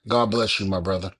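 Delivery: ordinary talk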